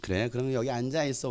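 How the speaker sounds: soft, low, indulgent tone; benevolent. Retold or imitated. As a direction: let the kid be, kindly and quietly. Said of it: "저는 인자하니까".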